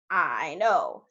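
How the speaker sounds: low, measured admission; grave chief frog. Acted.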